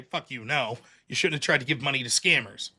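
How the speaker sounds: low, quiet brush-off voice; a lightly voiced uncaring support rep, low and soft; mock dismissive company. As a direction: sneer it out low as a company refusing.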